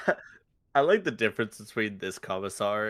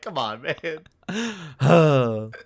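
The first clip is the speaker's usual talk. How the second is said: exasperated; 8 semitones higher, 13 dB louder, much slower than usual. Retold or imitated. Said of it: "I was like".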